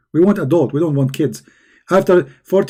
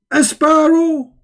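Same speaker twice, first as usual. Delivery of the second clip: mocking disbelief; 11 semitones higher, slower than usual. Real, not acted. Mocking the story's claim.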